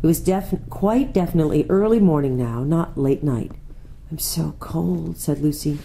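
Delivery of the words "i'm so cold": put-on soft, slow complaint from a cold child; hushed, drawn-out complaint; cold little girl. A narrator's reading. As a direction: say it small and slow, chilled. Lucy, cold, voiced in story.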